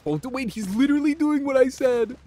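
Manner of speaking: high, loud, startled outburst; excited shout. Real, not acted. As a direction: yell it in disbelief. Surprised reaction to game.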